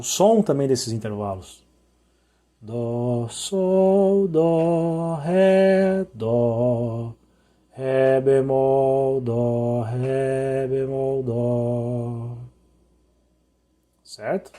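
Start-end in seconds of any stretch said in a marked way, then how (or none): none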